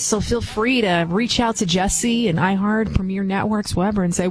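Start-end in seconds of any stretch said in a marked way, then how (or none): none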